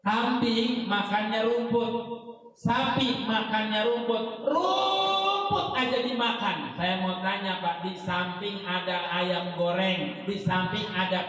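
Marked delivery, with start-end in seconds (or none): 4.5-6.7 mock amazement. Mocking animals eating grass.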